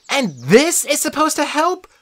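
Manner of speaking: put-on high, loud girl's objection; an angry friend rejecting the plan; high, indignant girl; protesting Annabeth. A narrator's reading.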